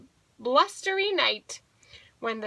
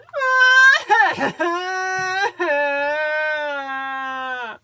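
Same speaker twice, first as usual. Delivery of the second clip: loud, long mock sobbing; hammed-up "boo-hoo" cry trailing out; blubbering wail; 3 semitones higher, 7 dB louder than usual. A narrator's reading. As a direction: bawl like a big baby bear. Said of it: "and the bear blubbers on".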